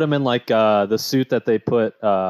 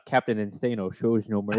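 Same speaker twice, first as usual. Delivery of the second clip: lightly put-on movie character voice, softer; half-voiced comic film quote; a hint of a goofy character's boast; Bobby Boucher; 2 semitones lower, 6 dB quieter than usual. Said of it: "give it the old Bobby Boucher".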